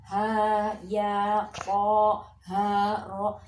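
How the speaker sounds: evenly chanted, firm "Ha, ya", each syllable held; two-beat syllables. Shown as a demonstration. Teacher demonstrates two harokat per wave, even held syllables. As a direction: hold "Ha, ya" two even beats each, on one level note. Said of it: "Dua harokat, satu gelombang".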